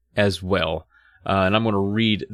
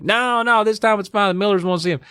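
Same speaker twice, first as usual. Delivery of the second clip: high mock dad voice; dad's voice; 11 semitones higher than usual. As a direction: reassure in a goofy high voice. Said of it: "he's like".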